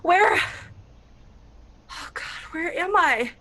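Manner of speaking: high, frantic, disoriented panic; panicked confused questions; panicked waking. Acted. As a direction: wake from a nightmare in terror. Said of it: "Sweating and crying".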